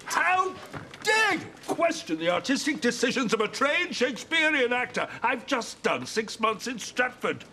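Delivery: indignant outburst. Real, not acted. Actor storms off, indignant.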